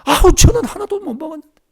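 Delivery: high, loud aggrieved whine; whiny excuse. Retold or imitated. Missionary answering the Lord, high aggrieved whine. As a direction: complain like a sulking child.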